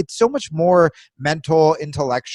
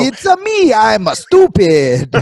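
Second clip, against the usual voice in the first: high, loud cartoon Italian accent, dragging the last word; hammed-up Italian accent, high and stretched; 8 semitones higher, 7 dB louder, slower than usual. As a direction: play a dim cartoon Italian. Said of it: "anybody in a stereotypical Italian accent is hysterical".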